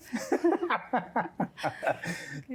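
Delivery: high, rolling laughter; hearty laugh. Real, not acted. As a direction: laugh at your own story. Laughing at his own anecdote. (ऊँची, खुलकर हँसी)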